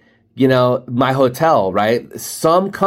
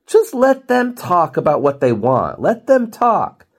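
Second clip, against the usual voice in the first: high, insistent plea; exasperated advice; 6 semitones higher, slower than usual. Real, not acted. Adviser to pushy salesperson, emphatic exasperation. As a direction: hammer it home, pitch climbing, drawn-out ending.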